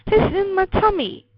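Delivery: high, loud insistence; crying out. A narrator's reading. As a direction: insist excitedly like a child proved right. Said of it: "I cried".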